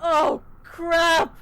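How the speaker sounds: high-pitched alarmed yelp; panicked cry. Acted. Player reacting to falling from the sky, panicked cry. Character reacts to free fall.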